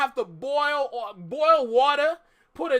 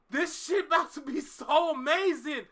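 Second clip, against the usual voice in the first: ordinary delivery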